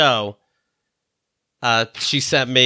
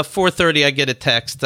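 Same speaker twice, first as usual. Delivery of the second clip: normal talking voice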